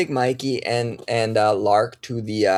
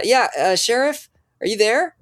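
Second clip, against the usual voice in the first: high, raised woman's voice calling in; radio caller voice; 10 semitones higher than usual. Acted. Dispatcher calling the sheriff over the radio, high voice.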